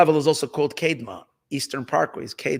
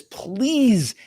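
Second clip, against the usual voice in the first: mildly pleading, higher and drawn out; imitated pleading; 4 semitones higher than usual. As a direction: stretch the word in a mock beg. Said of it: "Hashem is begging".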